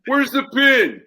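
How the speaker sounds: loud call across the yard; yelling to his buddy. Retold or imitated. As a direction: shout it up to a buddy far off.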